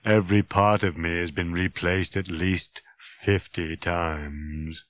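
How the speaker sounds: low, dreary robot monotone; gloomy robot voice, fading at the end; droning. Acted. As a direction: drone it out, weary and worn. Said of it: "he droned".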